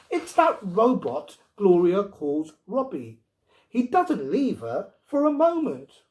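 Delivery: rising protest about the robot; indignant wife. Acted.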